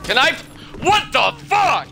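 loud, high cry of disbelief; exasperated shout. Real, not acted. Player killed in the game, exasperated shout. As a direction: yell it out in frustration.

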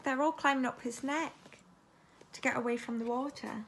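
ordinary delivery

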